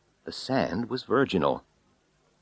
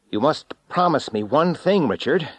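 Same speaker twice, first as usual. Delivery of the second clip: raised, higher voice shouting over wind; calling over the wind; 9 semitones higher, 5 dB louder than usual. A narrator's reading. Narrator shouting to Richard over the rising wind. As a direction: call out above a storm. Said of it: "I said over the rising wind".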